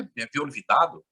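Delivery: ordinary talk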